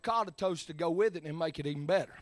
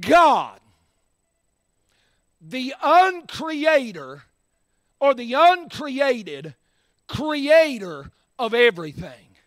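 loud, drawn-out preaching of the quote; slow loud preacher repeat; preached quote; 5 semitones higher, 9 dB louder, slower than usual. Shown as a demonstration. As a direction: hammer the name slowly. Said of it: "Can I just say that?".

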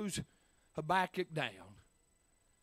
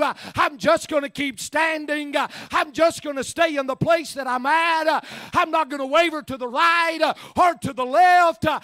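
sermon cadence climbing to a loud, higher-pitched peak; preaching, building up; 7 semitones higher, 10 dB louder than usual. Real, not acted. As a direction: build steadily into full-voiced preaching. Sermon builds to full force.

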